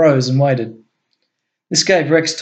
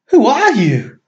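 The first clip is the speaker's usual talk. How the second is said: much higher, awed and shaken; bewildered; 10 semitones higher, 4 dB louder, slower than usual. A narrator's reading. Guard kneeling before Rex, bewildered. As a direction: ask it from your knees in awe. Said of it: "he looked up in bewilderment".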